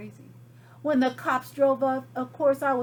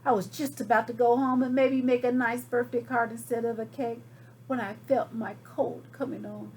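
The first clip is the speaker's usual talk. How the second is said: usual speaking voice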